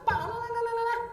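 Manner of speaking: imitating an angry wife's nagging in gibberish, high; mock wife nagging. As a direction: babble like a wife scolding, high. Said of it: "Kalau istrinya marah-marah".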